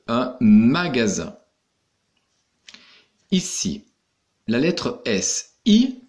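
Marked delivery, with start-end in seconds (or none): 0.1-1.3 "magasin" with the S voiced as Z. Shown as a demonstration. Teacher demonstrating the rule, "magasin" with clear Z. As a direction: sound out "magasin" carefully, buzzing Z. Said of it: "cette lettre se dira ze".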